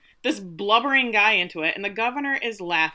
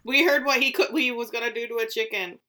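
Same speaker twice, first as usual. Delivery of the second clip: higher-pitched mock animal protest; voiced farm animal; 3 semitones higher than usual. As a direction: lightly voice a wary animal, pitched up. Voicing the farm animals objecting.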